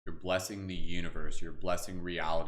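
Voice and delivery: slow, gentle meditation guide voice; guided meditation. Acted. Leads water blessing meditation.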